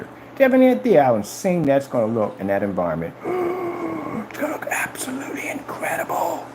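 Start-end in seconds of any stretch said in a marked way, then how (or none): 3.2-4.2 sharp high gasp of awe, softly; awed gasp. Acted. Picturing the screen, a soft high gasp. 4.3-6.4 high breathy whisper, much softer; awed whisper. Acted. Picturing the screen in the room, an awed high whisper.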